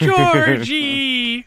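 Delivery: high, loud drawn-out call; mock calling out, stretched and high; Lady Hammer. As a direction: holler the name from the background. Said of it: "you hear Lady Hammer in the background".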